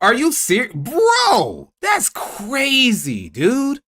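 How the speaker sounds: high, slow, drawn-out disbelief; a sarcastic mock gasp of outrage; mock shock. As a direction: act stunned in a hammed-up way. Mock disbelief at the pro-intervention take.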